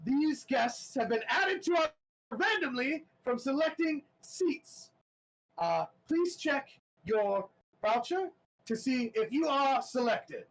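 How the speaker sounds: a jittery host forcing big cheer; nervous announcer. Acted. Announcer nervously introducing special guests, high and jittery. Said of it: "And he goes".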